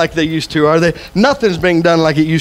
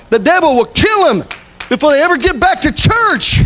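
raised pitch, driving sermon delivery; impassioned preaching; 4 semitones higher than usual. Real, not acted. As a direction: press it home to the congregation. Preaches at full force.

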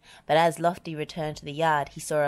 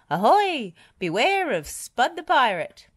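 high, loud mock pirate warning; pirate cry; 8 semitones higher, 7 dB louder than usual. A narrator's reading. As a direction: call out like a playful storybook pirate. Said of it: "he cried".

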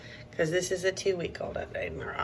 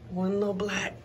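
ordinary talk

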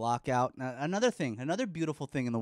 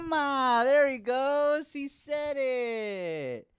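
excited; 10 semitones higher, much slower than usual. Real, not acted. Baby says first word.

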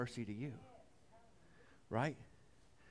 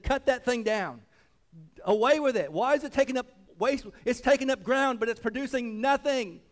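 loud, high, impatient master; acted indignant landowner; stern command; 8 semitones higher, 8 dB louder than usual. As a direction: order it gone at full preaching force. Master orders tree cut down.

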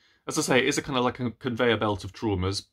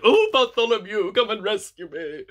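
higher, louder, swooning damsel plea; swooning fan; 10 semitones higher, 4 dB louder than usual. Retold or imitated. Reader swooning over Bartholomew, hammed-up damsel plea. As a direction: melt like a damsel calling her hero. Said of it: "I was like".

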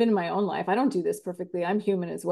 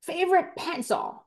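put-on higher, whiny child voice; child telling parent about a stolen pencil, whiny; upset kid; 6 semitones higher, slower than usual. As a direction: complain like a wronged little kid. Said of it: "And they went".

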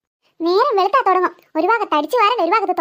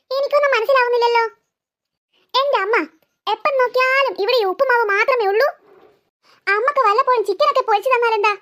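usual speaking voice

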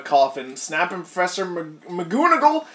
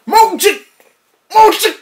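much higher, louder cry; hammed-up dramatic yell; dramatic shout; 13 dB louder than usual. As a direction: belt it like a title reveal. Title shouted.

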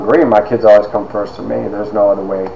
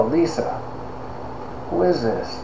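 hushed aside; 5 semitones higher, 14 dB quieter, slower than usual. Retold or imitated. Student discreetly asking a colleague who is speaking, hushed. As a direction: ask it softly, as if not to be overheard.

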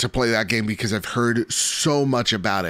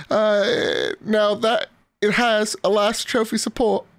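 hammed-up British accent, pitched much higher; a listener's letter read as a British gent; 9 semitones higher than usual. Podcast host greeting listeners at a viewer's request, hammed-up British accent. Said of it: "he wants me to talk in a British accent".

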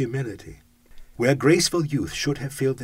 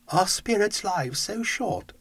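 put-on higher, slow, wondering old miser; old Scrooge voice; 10 semitones higher than usual. Scrooge asks the ghost about its short life, higher old voice. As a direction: ask it like an aged, anxious miser.

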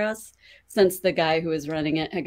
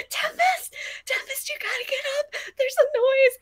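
much higher, urgent half-laughing plea; panicked plea. Retold or imitated. Waking her sleeping friend over a noise, panicked plea. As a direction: beg your friend to wake up, half giggling.

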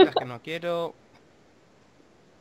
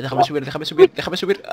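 rising panicky plea, louder; panicked pleading; 5 dB louder than usual. Real, not acted. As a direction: beg frantically to get up. Panicking in game, climbing out.

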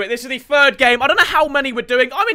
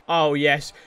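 ordinary delivery

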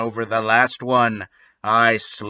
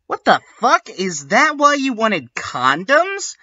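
sneering high shriek; sadistic; 10 semitones higher than usual. A narrator's reading. Ebony sneering at the two caught teachers. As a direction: shriek it with nasty glee. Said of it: "I asked sadistically".

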